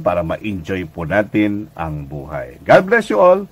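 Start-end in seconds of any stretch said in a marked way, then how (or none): 2.6-3.4 high, loud closing blessing; bright sign-off blessing. Real, not acted. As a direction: lift the voice for a warm farewell.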